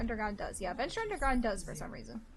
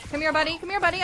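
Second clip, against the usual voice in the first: higher, louder teasing taunt; taunting call; 6 semitones higher, 7 dB louder, much faster than usual. Real, not acted. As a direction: lure the enemy in mockingly. Taunting enemy in fight.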